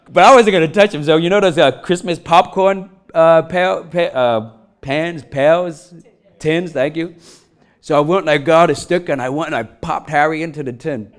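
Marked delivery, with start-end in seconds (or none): none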